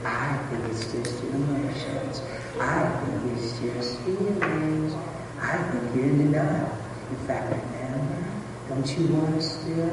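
sow doubt quietly and slyly; hushed, coaxing demon at her ear; tempting imp. Acted. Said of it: "And he says".

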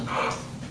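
sudden soft intake of breath; imitated gasp of realization; overwhelmed gasp. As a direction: gasp as if overwhelmed by what you see. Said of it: "She just went".

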